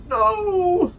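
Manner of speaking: sudden high loud No; dismayed cry. Real, not acted. Player crying out as something goes wrong, high dismayed cry. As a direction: cry out in shock. Reacting to in-game loss.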